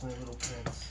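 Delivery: tender. Real, not acted. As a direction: coo lovingly at the cat. Cooing to the cat.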